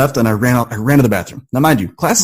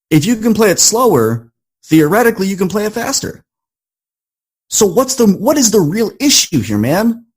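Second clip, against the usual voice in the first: self pep talk; 6 semitones higher than usual. Retold or imitated. Student in the bathroom giving himself an intense pep talk. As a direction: pitch rising high, urging himself on. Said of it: "I had this moment of clarity and I said".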